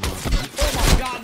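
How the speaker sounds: sudden loud alarm; startled shout. Real, not acted. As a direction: blurt it out in alarm. Spots enemy, alarmed.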